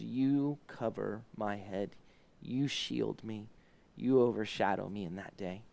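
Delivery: hushed prayer. Acted. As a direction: pray it gently, close to God. Quoted psalm said as prayer.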